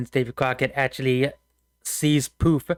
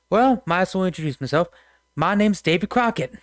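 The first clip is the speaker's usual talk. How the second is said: folksy frontiersman, pitched higher; Davy Crockett; 5 semitones higher than usual. Acted. Game master introduces Crockett to the players, high folksy voice. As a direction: introduce yourself in a country voice. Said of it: "he just goes".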